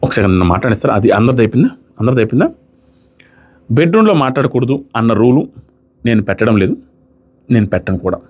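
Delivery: calm him down with a grave, steady voice; a housemate stepping in seriously to settle the fight, low and grave. Retold or imitated. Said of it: "సీరియస్గా వచ్చి".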